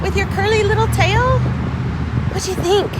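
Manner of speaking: talking to a dog. Real, not acted. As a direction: coo at the dog. Greeting the dog.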